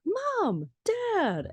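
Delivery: very high child's call, imitated; excited calling out. Max running down to his parents, excited calling.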